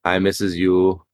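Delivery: ordinary talk, nothing marked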